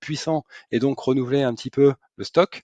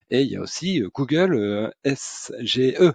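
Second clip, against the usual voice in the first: usual speaking voice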